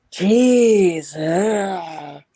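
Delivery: disgusted. Retold or imitated. Smell of field dressing deer.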